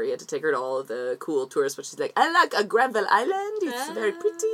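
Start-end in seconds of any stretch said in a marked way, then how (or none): 2.2-4.5 put-on much higher, light French Canadian accent. Mimicking a friend's French Canadian partner, higher French Canadian accent. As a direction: imitate a French Canadian visitor, pitched up. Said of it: "She is French Canadian".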